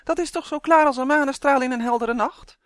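high, loud buzzing elf voice. A narrator's reading. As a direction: buzz it out high and insistent. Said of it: "zoemde Uf".

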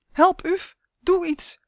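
high, urgent call for help; panicked plea to a friend; desperate cry. A narrator's reading. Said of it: "riep hij wanhopig".

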